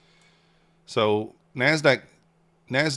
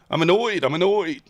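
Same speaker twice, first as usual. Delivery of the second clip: higher and louder, venting twice; loud annoyance; 8 semitones higher, 5 dB louder than usual. Real, not acted. Trader venting at his stream after a stopped trade, loud annoyance. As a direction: blurt out the annoyance.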